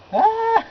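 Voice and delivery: high, loud yelp of panic; a sudden scared shriek; retold scream. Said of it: "and he's like".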